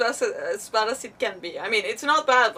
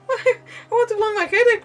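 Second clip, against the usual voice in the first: words breaking into laughter, louder; laughing; 11 semitones higher, 8 dB louder than usual. Real, not acted. Streamer tripping over words, laughing through them.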